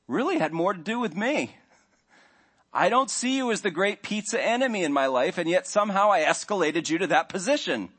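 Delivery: rising, earnest mock confession to spouse; confessing to your spouse over pizza, voice climbing. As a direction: play the sheepish spouse owning up. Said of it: "you don't really talk like this".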